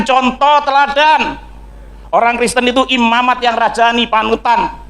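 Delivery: high, insistent sermon delivery; preaching at full force. Real, not acted. Preacher exhorts congregation about being chosen people, preaching at full force. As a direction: preach it high, drawing out key words.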